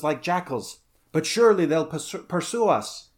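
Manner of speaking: high, urgent account of horror; alarmed corsair. A narrator's reading. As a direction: plead your case as a shaken survivor. Second corsair recounts the demon attack.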